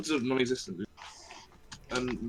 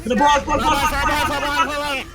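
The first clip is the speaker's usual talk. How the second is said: high loud repeated shout; shouting callouts; 13 semitones higher, 9 dB louder, slower than usual. Real, not acted. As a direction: yell it out in the heat of battle. Calling target mid fight.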